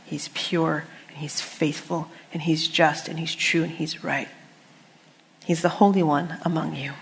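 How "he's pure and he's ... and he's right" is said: softly whispered, reverent; hushed awe, barely voiced. Real, not acted. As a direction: drop to a near whisper for each trait. Whispered reverent list of God's traits.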